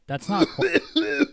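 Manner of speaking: high-pitched drawn-out squeal of disbelief; squeaky disbelief. Retold or imitated. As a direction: let it crack up high in shock. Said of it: "I thought, I'm like".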